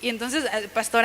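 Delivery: ordinary delivery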